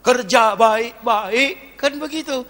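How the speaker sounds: high-pitched insistent exhortation; emphatic preaching. Real, not acted. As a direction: press the warning on the congregation. (khotbah tegas bernada tinggi)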